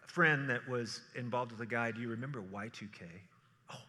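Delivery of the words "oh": ordinary talk, nothing marked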